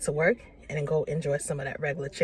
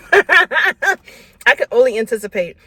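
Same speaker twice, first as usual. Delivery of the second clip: laughing; 6 semitones higher, 11 dB louder, much slower than usual. Real, not acted. Laughing at her growling stomach.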